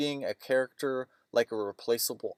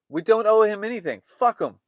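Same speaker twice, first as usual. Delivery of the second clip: angry; 9 semitones higher, 8 dB louder than usual. Retold or imitated. Friend angrily dismisses Gary.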